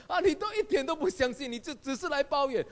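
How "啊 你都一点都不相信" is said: ordinary talk, nothing marked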